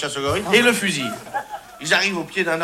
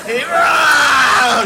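hammed-up charge shout; 7 semitones higher, 6 dB louder than usual.

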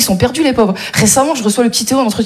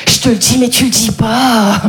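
slow conspiratorial aside; 4 semitones lower, slower than usual. Retold or imitated.

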